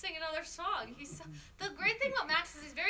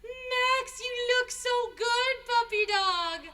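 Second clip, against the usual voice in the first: higher, louder praise for a puppy; cheering on the dog in a higher, louder voice; 6 semitones higher, 6 dB louder, slower than usual. Real, not acted.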